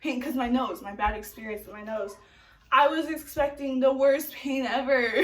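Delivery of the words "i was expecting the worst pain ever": loud, laughing through the words; cracking up at her own fear; real laughter while admitting it. Real, not acted.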